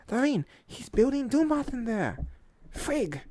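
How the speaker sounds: high, loud squirrel warning; Tippy Toe. Acted. Squirrel warning Squirrel Girl, high urgent shout. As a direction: call out the alarm high and urgent. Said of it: "she warns Squirrel Girl".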